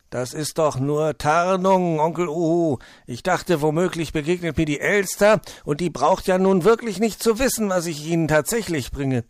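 high, loud, animated fox voice; lively fox justifying himself; fox character explaining. Acted. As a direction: confide the trick with storybook gusto. Fox explains disguise to owl.

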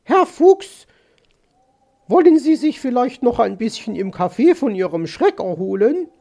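high, loud calling bird-lady voice; solicitous magpie lady. Acted. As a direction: coax the fox as Mrs. Magpie. (besorgt flötende Elster)

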